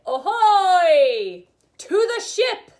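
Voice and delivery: loud, high belted call; pirate chest voice. Shown as a demonstration. Teacher demonstrating chest voice for fun, pirate shout. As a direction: holler it like a pirate across the deck. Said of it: "ways to use our chest voice".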